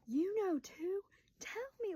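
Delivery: high, incredulous retold questioning; mock-exasperated sister; imitated pleading demand.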